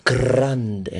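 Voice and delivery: "Grande" with a long trilled R; rolled R. Shown as a demonstration. Showing co-hosts he can roll his R's, trilled R on "Grande". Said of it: "he can roll his R's really good".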